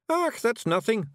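high, slow Scots scoff; boastful Scottish Feegle. A narrator's reading. Rob Anybody topping Miss Level's two bodies, boastful.

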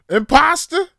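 loud high squeal of imposter; mock yell. Mocking outsider from Toronto, loud high yell. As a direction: shriek the word in mock outrage. Calling out fake local.

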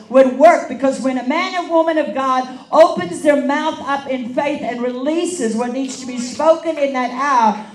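loud, driving declaration to a congregation; full-force preaching. Real, not acted. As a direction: preach it with surging, emphatic conviction.

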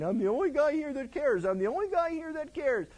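hammed-up self-pitying whine; whiny complaining. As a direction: whine it like a sulking prophet. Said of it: "whining and complaining".

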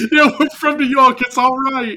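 high, loud laughing bro imitation; mock New York bro. Retelling the fan's comeback to a dad, high and laughing. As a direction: ham up the cocky fan's reply. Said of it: "He goes".

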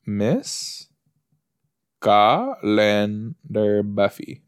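very slow "Miss... Ca-len-dar Buffy", with gaps; slow sounding-out. Real, not acted. Looking the name up on the internet, slowly sounded out. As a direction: stretch CALENDAR syllable by syllable. Sounding out words while searching online.

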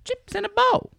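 very high, silly sing-song announcement; high squeaky voice. Pretend. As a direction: squeak it out like a cartoon. Catchphrase for favorite show segment.

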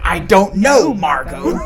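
loud, high "know" in a mock retort; hammed-up retort punching "know"; stress on know. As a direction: shout "know" as the movie catchphrase. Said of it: "in that tone".

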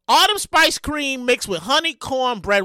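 usual speaking voice

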